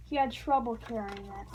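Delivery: ordinary delivery, nothing marked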